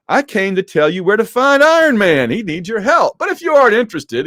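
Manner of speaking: loud, high-pitched dramatic plea; hammed-up urgent news, quickening at the end; urgent announcement. Spidey telling the Avengers Iron Man needs help, urgent announcement.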